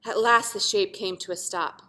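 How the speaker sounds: ordinary delivery, nothing marked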